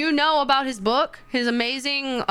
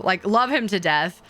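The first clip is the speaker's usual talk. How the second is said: normal talking voice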